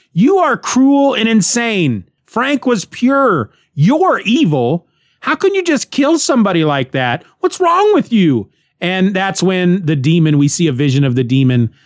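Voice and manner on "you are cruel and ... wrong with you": high, upset accusing cry; angry accusation. Retold or imitated. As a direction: hurl the accusation, appalled. Joe confronts Mick after killing.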